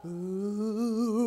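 long, drawn-out spooky ooh; ghostly ooh. Shown as a demonstration. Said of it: "Does that sound like a ghost?".

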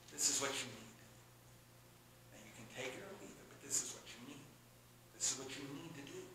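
low, very soft, direct counsel; hushed, caring firmness; straight-up, with love. Acted. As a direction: tell them plainly but lovingly. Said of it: "he did it with love".

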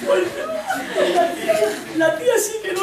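high, laughing lad voices; hammed-up excited teen boys; teen lads gossiping. Two teen friends gossiping about the film, high and laughing.

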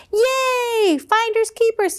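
high, joyful cry; excited squirrel. Acted. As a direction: shout it with glee. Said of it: "he cried".

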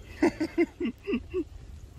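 high, soft giggle; real laugh. Real, not acted. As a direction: giggle in a high, light string. Amused during the catch. (cười khúc khích, giọng cao)

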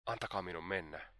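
soft, subdued plea; hushed, imploring request; pleading. A narrator's reading. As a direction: beg quietly to be released. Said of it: "sanoi Dunja rukoilevasti".

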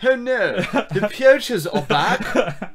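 high, slow put-on South African guide; English South African accent. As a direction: announce yourself like a hammy safari guide, English South African. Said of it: "English South African accent".

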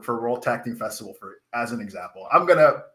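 ordinary talk, nothing marked

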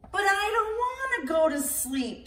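a little one begging to stay up; whiny child. Acted.

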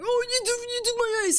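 high, loud mock teammate yell; whiny furious accusation; mock outrage. Teammate outraged over a stolen ace, mock yell. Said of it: "and then he was like".

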